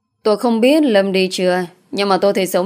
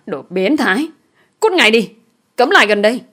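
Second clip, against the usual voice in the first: higher, louder angry commands; angry rebuff; 4 semitones higher, 5 dB louder than usual. A narrator's reading. Woman ordering her attacker to keep away, angry. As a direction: drive him off in sharp outrage.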